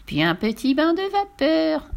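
high-pitched teasing witch; witch's high sing-song threat; gloating witch. Acted. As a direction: announce the next torment with relish. Witch teases the boy.

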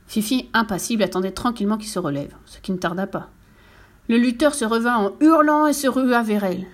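plain reading voice

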